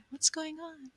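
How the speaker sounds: high, soft mock-sneaky question; furtive snooper. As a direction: imitate someone peeking round a corner. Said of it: "snooping around the corner".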